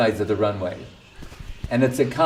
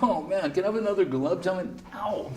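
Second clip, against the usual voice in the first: stuffed glutton; 7 semitones higher, 7 dB quieter than usual. Pretend. Overstuffed devotee at the feast.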